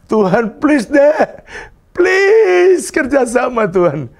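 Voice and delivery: high, slow, wheedling please to God; whiny plea. Retold or imitated. Pastor begging God to cooperate, high and wheedling. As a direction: coax God like a pleading child.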